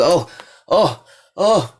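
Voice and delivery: rising, astonished cries of oh; stunned oh. Retold or imitated. Broke man finding a winning lotto ticket, stunned cries. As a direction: gasp it out like you just struck it rich.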